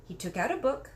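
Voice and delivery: plain reading voice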